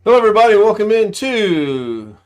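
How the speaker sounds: booming show host welcome; grand entrance announcement. Acted. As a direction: belt it out like a showman opening. Host opens the live show.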